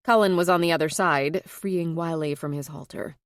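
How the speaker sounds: ordinary delivery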